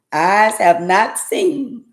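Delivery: slow, loud church proclamation; slow preaching. Real, not acted. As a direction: draw it out like a preacher. Praise, slow.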